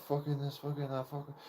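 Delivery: lightly voiced mocking swear rant; half-hearted mock tirade; imitating mum swearing. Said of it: "she starts swearing at me".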